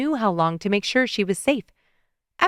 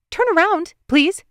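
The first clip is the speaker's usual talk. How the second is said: exasperated demand; 10 semitones higher, 3 dB louder than usual. Acted. Mel orders men to turn.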